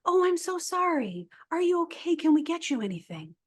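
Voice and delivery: high, fluttery mock concern; sympathetic colleagues. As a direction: coo over a sick coworker, lightly imitated. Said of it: "And they said".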